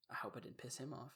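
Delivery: soft, hushed worried aside to himself; a nervous little murmur under the breath; worried inner thought. Retold or imitated. Narrator recalling his fear of the classmate, hushed and worried.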